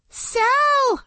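high desperate scream; girl screaming at her friend. Acted. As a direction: scream his name across the street. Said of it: "I screamed".

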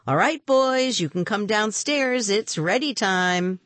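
high singsong aunt's call. A narrator's reading. Aunt Nora calls the boys downstairs, calling out. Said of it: "Then Aunt Nora called".

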